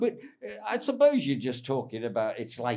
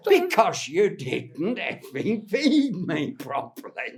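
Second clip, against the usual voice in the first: slow, pointed, a bit louder telling-off; mock angry old comic; 6 semitones higher, 7 dB louder, slower than usual. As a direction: spell out the grievance slowly to the young actor. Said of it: "He said".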